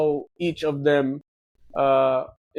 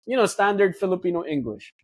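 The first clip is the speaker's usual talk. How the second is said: ordinary delivery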